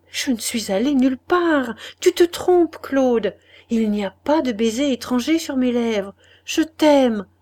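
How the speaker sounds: loud, high and pleading; a loud, urgent plea of love; desperate protest. A narrator's reading. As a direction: beg to be believed. Laurence protests her love.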